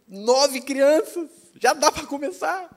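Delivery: high, slow, loud stretched-out triumph; reenacted thrilled news to his wife; elated announcement. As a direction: stretch each word with rising excitement.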